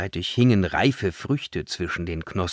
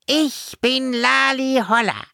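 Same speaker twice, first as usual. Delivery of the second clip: very high fairy voice, self-important; high-pitched storybook fairy; cheery little fairy; 3 dB louder than usual. A narrator's reading. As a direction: introduce yourself as a cute tiny fairy. Fairy introduces herself.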